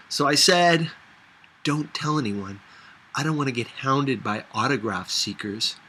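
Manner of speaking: ordinary delivery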